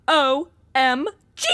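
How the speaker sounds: gasped, drawn-out OMG; stunned at the mess; shocked gasp. Acted. Roommate gasping at the trashed room, shocked.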